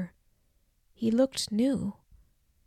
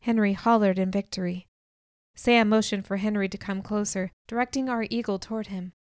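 usual speaking voice

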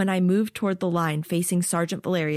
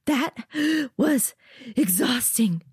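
high, breathless and panting; 7 semitones higher, slower than usual. Acted. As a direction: gasp it out, winded.

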